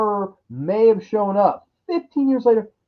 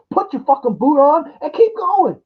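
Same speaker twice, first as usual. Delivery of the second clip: normal talking voice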